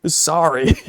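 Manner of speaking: normal talking voice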